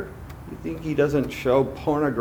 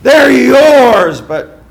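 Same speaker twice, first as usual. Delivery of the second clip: devil flattering; 3 semitones higher, 7 dB louder than usual. Acted. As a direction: swell with grand false praise. Preacher voicing the devil.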